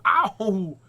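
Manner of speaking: loud, high "ow"; sharp jump up in pain; pained yelp. Pretend. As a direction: cry out at a yanked hair. Hair being pulled.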